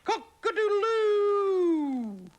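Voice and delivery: very high, loud, drawn-out rooster call; cock-a-doodle-doo. Acted. Rooster waking everybody on the farm, loud high crow. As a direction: belt out a slow rooster crow. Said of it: "thought the cock".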